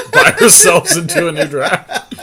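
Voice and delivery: giggling out the punchline; laughing. Real, not acted. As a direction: still laughing from the joke. Joking about conceding the draft.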